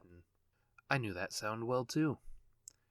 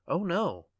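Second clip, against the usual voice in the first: sharp, louder burst of denial; emphatic cry; 7 semitones higher, 9 dB louder than usual. A narrator's reading. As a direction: fling out the denial. Narrator's emphatic exclamation.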